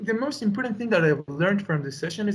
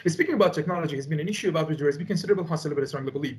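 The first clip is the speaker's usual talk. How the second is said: rapid-fire; 2 semitones lower, much faster than usual. Shown as a demonstration. Student demonstrating to the tutor the too-fast exam delivery, rapid-fire. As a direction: rattle it off very fast without a breath. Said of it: "this one is in a fast way".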